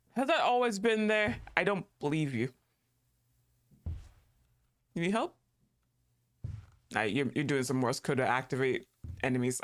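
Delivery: usual speaking voice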